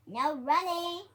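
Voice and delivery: high, loud shout of the rule; cheerful librarian bellowing her rule; joyful shout. Acted. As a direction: call it out loud, slowing on the repeat. Librarian shouting rule after running.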